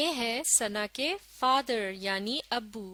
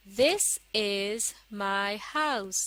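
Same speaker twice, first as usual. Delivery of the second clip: very slow, word by word; slow model reading; much slower than usual. Shown as a demonstration. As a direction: read it out slowly for learners to follow. Second model reading for learners. (धीरे-धीरे, एक-एक शब्द पढ़कर)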